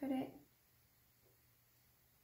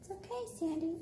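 soothing the dog; 6 semitones higher, faster than usual. Real, not acted. Calming the dog again.

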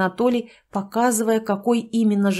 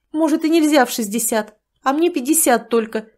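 higher, louder hurt retort; offended; 5 semitones higher, 4 dB louder than usual. Acted. Tatyana to Sanych, offended and pouting. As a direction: sound wounded and pouting. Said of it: "поджала обиженно губы".

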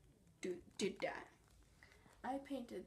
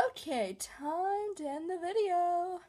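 loud, slow, higher sign-off; big announcement; 6 semitones higher, 9 dB louder than usual. Real, not acted. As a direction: announce the ending loudly and slowly. Announcing end of vlog.

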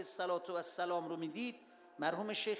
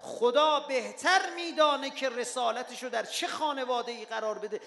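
loud cry of praise; 7 semitones higher, 9 dB louder than usual. Retold or imitated. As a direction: cry the verse out to the whole mosque. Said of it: "یه دفعه فریاد زد".

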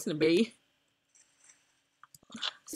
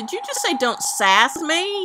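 incredulous echo; 6 semitones higher, 6 dB louder than usual. Real, not acted. Player questioning teammate's "don't sass me", incredulous. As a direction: throw it back in loud, high disbelief.

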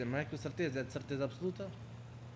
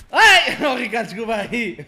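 loud, high cry melting into laughter; laughing apology; 5 semitones higher, 13 dB louder than usual. Real, not acted. Gamer apologising to teammate Ricardo, laughing loudly. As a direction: apologise while cracking up.

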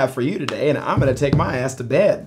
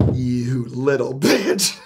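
slow seething insult; 3 semitones lower, much slower than usual. Retold or imitated. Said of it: "as soon as my fingers".

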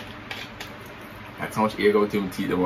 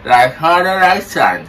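talking with mouth held open; words through a propped-open mouth; 5 semitones higher, 6 dB louder than usual. Pretend. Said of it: "you say you're not pronounce good".

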